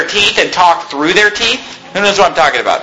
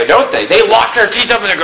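usual speaking voice

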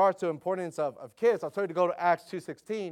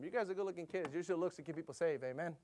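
put-on joking with kids; 7 dB quieter than usual. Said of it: "we kinda sometimes joke around with them".

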